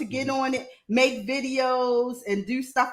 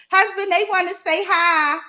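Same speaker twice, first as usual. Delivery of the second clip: raised, higher call to someone off-mic; 7 semitones higher, 4 dB louder than usual. Real, not acted. Wife summons husband to greet the interviewers, calling out. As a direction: holler for your husband.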